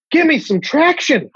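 high, comic demand for attention; mock pleading. Comic begging to get canceled for an old bit, mock plea.

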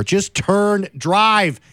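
high, exasperated demand; exasperated driver. Acted. Venting about slow turners, exasperated driver. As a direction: yell at a driver who won't move.

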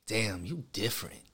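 smooth pickup line. Retold or imitated. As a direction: purr it to a girl at the bar. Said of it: "You see how I said different?".

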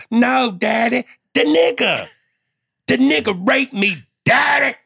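very high, loud mock wail; shrieky put-on child voice; hammed-up child. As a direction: play a distressed kid crying out to daddy. Acts child answering daddy in skit.

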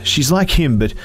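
put-on boy pleading after crying; tearful Jesse. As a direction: urge her through tears.

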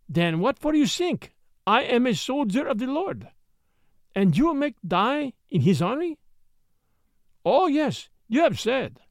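put-on high Spanish-accented broken English; high, childlike foreign English, Spanish accent. A narrator's reading. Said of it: "in a broken English that seemed as much infantine as foreign".

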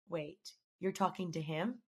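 friend's quiet, slow double-check; hushed disbelief. Retold or imitated. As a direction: ask it low and slow, taken aback. Friend reacts to the name.

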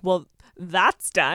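stern. Retold or imitated. Stern parent verdict.

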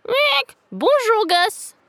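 high, slow crowing rooster voice; cockerel voice. A narrator's reading. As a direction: crow the greeting like a jolly cockerel. Cockerel greets Gus.

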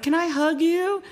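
high, slow little plea; imitated pleading. Grown fan asking a princess for a hug, high imitated pleading. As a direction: ask it high and drawn out, like a starstruck fan.

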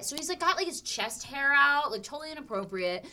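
usual speaking voice

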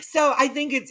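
high, laughing restart; through laughter. Real, not acted. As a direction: start talking before the laugh has finished. Recovering from laughing at guest's joke.

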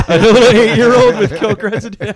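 laughing hard while talking; words breaking into laughter. Real, not acted. Laughing at his own story.

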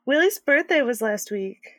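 normal talking voice

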